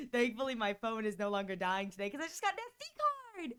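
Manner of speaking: usual speaking voice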